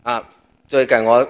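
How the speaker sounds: ordinary talk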